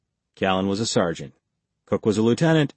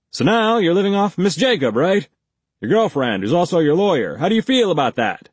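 higher, louder pushy cop voice; gruff interrogator; 8 semitones higher, 5 dB louder than usual. A narrator's reading. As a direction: press him like a hostile detective. Blake needles Reacher.

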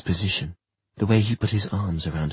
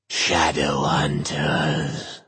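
slow, drawn-out otherworldly; an eerie voice from everywhere; eerie demon voice; 3 semitones lower, much slower than usual. Acted.

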